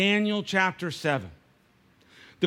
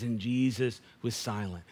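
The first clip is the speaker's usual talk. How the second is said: low and soft, almost under the breath; quiet, reverent low reading; hushed; 8 semitones lower, 7 dB quieter than usual. Shown as a demonstration. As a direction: let the words sink to a hush. Same verse repeated, hushed.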